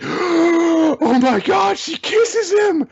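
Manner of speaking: very high, loud, drawn-out squeal; gasp, then squeal each word out slowly; gasping, thrilled exclamation. Real, not acted. Fan reacting to a surprise kiss, squealing with excitement.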